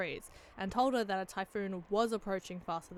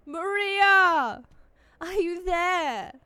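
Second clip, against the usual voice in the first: shouted, drawn-out calling; calling into the wind; frantic calling; 9 semitones higher, 11 dB louder, much slower than usual. Acted. Rosa searching for her daughter.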